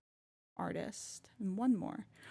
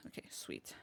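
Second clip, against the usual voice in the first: soft breathy whisper; 17 dB quieter than usual. Real, not acted. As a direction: whisper it to yourself. Whispered aside.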